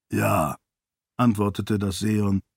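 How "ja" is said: lightly deepened, measured voice; deep voice. A narrator's reading. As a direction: speak with a hint of deep solemnity. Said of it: "Arshes tiefe Stimme". (leicht vertiefte, ruhige Stimme)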